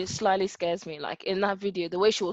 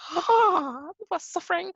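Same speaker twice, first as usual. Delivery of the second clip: high-pitched mock dismay; retold dismayed reaction; 9 semitones higher than usual. Reacting to stepmom's prison stories, mock dismay. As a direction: gasp it out high, as if appalled. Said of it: "I'm like".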